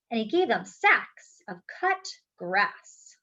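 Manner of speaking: lively, expressive read-aloud storytime voice; reading to children. A narrator's reading. Teacher reading a picture book aloud to the class, lively storytelling. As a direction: bring the picture book alive for the kids.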